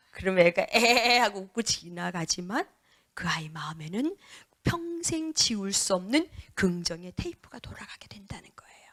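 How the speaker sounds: normal talking voice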